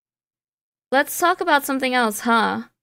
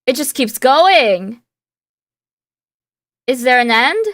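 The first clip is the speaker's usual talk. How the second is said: exasperated; 6 dB louder than usual. Real, not acted. Exasperated at endless list of answers.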